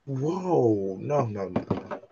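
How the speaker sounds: gasp, then a trailing whispered no; mock offended. Real, not acted. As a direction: act shocked, fading to a whisper. Mock offended at bias claim.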